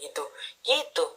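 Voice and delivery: usual speaking voice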